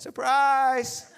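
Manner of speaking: high, drawn-out playful reveal; playfully hammed-up announcement; mock surprise. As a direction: sing it out like a jokey surprise. Mock reveal to those who didn't know.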